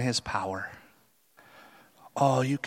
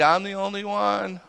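venting; 7 semitones higher than usual. Retold or imitated. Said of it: "Remember, he's venting it".

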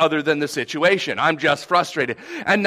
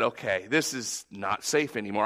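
quiet resigned admission; 8 semitones lower, 7 dB quieter than usual. Retold or imitated. Giving in after driving through storm.

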